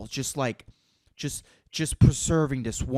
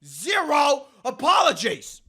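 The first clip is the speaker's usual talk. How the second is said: mock proclamation; 10 semitones higher, slower than usual. Opening proclamation, high far voice.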